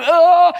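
sudden high yelp as you fall. Retold or imitated. Preacher acting out a snowboard fall, yelping. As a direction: yelp like you just lost your footing. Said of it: "you go".

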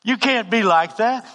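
high, loud, stretched-out scolding; acted rebuke. The Lord corrects the preacher, loud drawn-out rebuke. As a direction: admonish with mock exasperation.

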